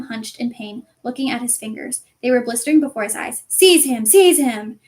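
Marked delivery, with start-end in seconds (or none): 3.5-4.8 loud repeated shrieked order. Acted. Voldemort to Quirrell, loud shriek. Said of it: "shrieked Voldemort again".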